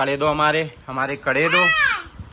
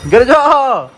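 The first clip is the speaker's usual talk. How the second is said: calling out; 10 semitones higher, 6 dB louder, much slower than usual. Real, not acted. Child near edge.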